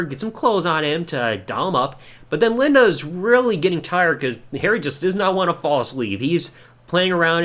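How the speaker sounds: normal talking voice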